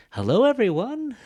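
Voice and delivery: very high, loud "Hello, everyone" with a huge swoop; exaggerated wrong intonation. Shown as a demonstration. Teacher shows learners what nobody does, exaggerated high intonation. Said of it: "nobody does that. Don't do that".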